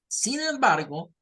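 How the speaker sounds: normal talking voice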